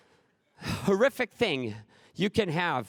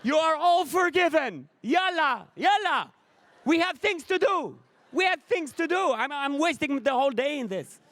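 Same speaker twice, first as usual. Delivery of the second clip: exasperated proclamation; 8 semitones higher than usual. Retold or imitated. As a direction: shout it to the whole street. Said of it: "stand in the middle of the street and say".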